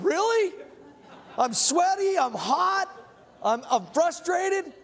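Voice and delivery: high-pitched, incredulous questioning; mock disbelief. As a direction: replay your baffled inner reaction, pitched right up. Retelling his disbelieving inner reaction.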